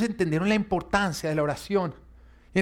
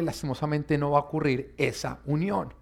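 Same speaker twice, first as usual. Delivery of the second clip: usual speaking voice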